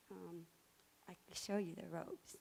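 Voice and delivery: normal talking voice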